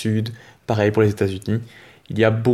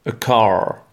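American English. Shown as a demonstration. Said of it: "ne se prononce pas".

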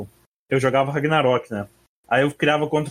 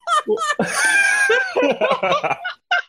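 sudden high shrieking laugh; screaming laughter. Real, not acted. Hysterical laugh at troll.

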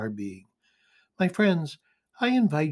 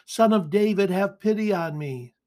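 higher, louder opening that eases off; restrained but insistent plea; insistent calling out; 7 semitones higher, 4 dB louder than usual. A narrator's reading. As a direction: keep calling for mercy, without shouting. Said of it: "he kept calling all the more".

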